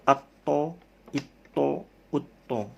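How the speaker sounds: Atto, itto, utto with a bouncing stop on the t; qalqalah bounce. Shown as a demonstration. Teacher to class, bouncing qalqalah drill. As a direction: make the t of Atto rebound. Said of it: "di sini ada kola-kola".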